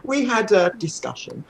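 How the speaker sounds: ordinary delivery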